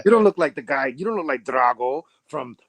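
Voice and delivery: mock Drago voice; playful Ivan Drago impression; Russian tough guy. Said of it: "I was like".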